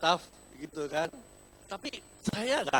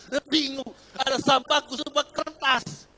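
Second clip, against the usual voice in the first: higher and louder, laughing through it; laughing confusion; 11 semitones higher, 9 dB louder than usual. Retold or imitated. Pastor laughing to the congregation about a paper-only bin, high and loud.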